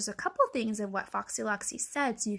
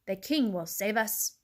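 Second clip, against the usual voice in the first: ordinary delivery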